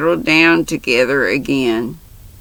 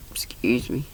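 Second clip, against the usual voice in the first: whispered; 5 semitones lower, 13 dB quieter than usual. Real, not acted. Aside after a cough.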